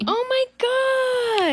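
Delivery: high, slow drawn-out gasp of amazement; genuinely amazed, drawn out. Real, not acted. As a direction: stretch it out in real disbelief. Amazed sketch is so old.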